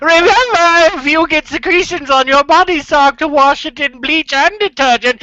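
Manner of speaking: high mock-proper advice voice; prim lady voice. Parody etiquette advice voice.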